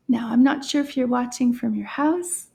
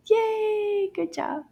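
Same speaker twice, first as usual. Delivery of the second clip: high, held sing-song cheer; teacher's high-pitched cheer for little learners; 9 semitones higher, much slower than usual. Real, not acted. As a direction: celebrate with a long high hooray.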